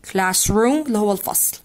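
ordinary talk, nothing marked

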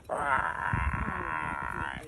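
low, subdued creaky growl; tame cougar growl. Retold or imitated. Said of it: "but you more like".

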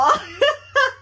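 high, cracking laugh through tears; a helpless little laugh while crying; squeaky tearful laugh. Real, not acted. Crying over gifted doll.

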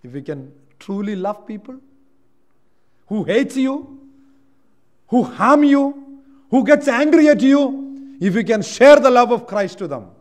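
rising from steady to loud and high; building preaching. Acted. Preacher urging listeners to love enemies, building to loud.

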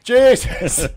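loud, high startled cry; startled shout. Real, not acted. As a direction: blurt it in shock. Startled exclamation in game.

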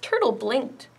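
ordinary delivery, nothing marked

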